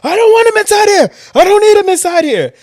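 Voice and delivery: high, loud furious yell at the newcomer; angry shout. Retold or imitated. Papo shouting at the new kid to keep him out, furious shout. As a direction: bellow him out of your section.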